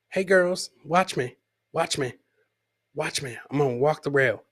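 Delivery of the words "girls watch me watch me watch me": normal talking voice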